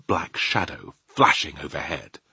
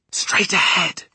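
forceful whispered direction; 11 semitones higher, 5 dB louder, slower than usual. Acted. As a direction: steer the giant in a stage whisper. Sophie directs the giant in secret.

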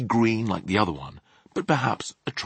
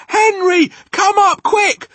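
high, loud urgent call; alarmed mother's holler; yelling; 13 dB louder, slower than usual. A narrator's reading. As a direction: yell upstairs for a husband. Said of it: "And she yells".

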